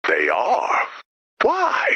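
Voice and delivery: sharp rising startled cry; shocked yelp of disbelief; startled shout. Acted. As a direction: blurt it out in shock. Startled questions.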